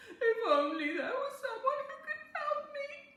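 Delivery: tearful, breaking high plea; weeping. A narrator's reading. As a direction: cry it out as you beg for help. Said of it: "she wept".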